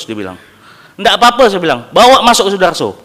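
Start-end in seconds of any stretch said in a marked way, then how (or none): 1.0-1.5 raised, pleading insistence; pushing back hard at the doctor; insistent retold reply. Father urging doctor, raised insistent voice. 1.9-3.0 louder, firm demand; urgent retold order. Father ordering doctor to admit baby, urgent demand.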